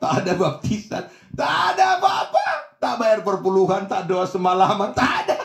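high, loud rapid-fire list; emphatic denial. Real, not acted. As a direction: punch each negation up toward a shout. Preacher vehemently lists what was absent. (penyangkalan lantang berapi-api)

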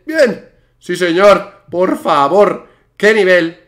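loud, punchy celebration; exultant gamer hyping himself up; triumphant cheering. Real, not acted. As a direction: shout each bit of self-praise. Celebrates successful jumps.

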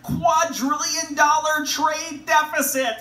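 loud, slow, high politician impression; hammed-up rally bluster, every word drawn out; Donald Trump. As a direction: stretch the figure out at full volume. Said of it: "Don't you love how he says".

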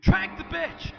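high loud comic yell; jokey shout over the game; yelled joke line. Acted. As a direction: bellow it for a laugh. Mock yelling during scene.

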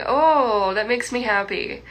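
ordinary talk

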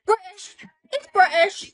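put-on high, loud British accent. Student claims soulmate is British, loud British accent. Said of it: "speaking in a British accent earlier".

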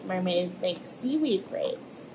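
usual speaking voice

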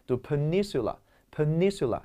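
peninsula with the stress on the second syllable, nin. Shown as a demonstration. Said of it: "关键是重音位置".

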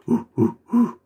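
very high, loud ape calls; monkey hoots. Retold or imitated. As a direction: hoot like a cartoon monkey. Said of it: "And Bingo going".

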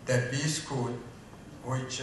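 ordinary talk, nothing marked

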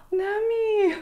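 high, drawn-out gasped name; aghast at the mirror; shocked gasp. Real, not acted. Gasps at her haircut.

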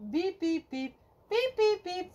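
usual speaking voice

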